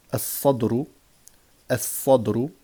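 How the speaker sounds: "as-sadru" with a long emphatic s, no L; doubled first letter. Shown as a demonstration. Teacher drilling sun-letter words, doubled emphatic s. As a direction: hold the heavy s in "as-sadru". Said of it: "on va doubler".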